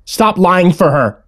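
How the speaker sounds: accusing mother. A narrator's reading. Mother scolds child for covering for sister, accusing.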